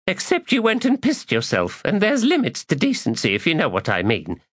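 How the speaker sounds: high, mocking woman's voice; teasing woman voice. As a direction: tease him in a high voice. Woman teases.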